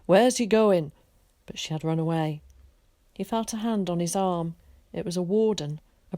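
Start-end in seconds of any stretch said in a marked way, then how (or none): 0.0-0.9 loud call after someone running off; shouting after her. A narrator's reading. Man calling after the girl who ran off, loud shout. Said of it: "He shouted after her".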